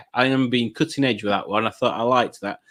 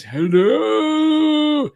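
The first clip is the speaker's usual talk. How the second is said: high, drawn-out mock French hello; French accent; 13 semitones higher than usual. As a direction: imitate her with a high French lilt. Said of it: "she says it in a French accent".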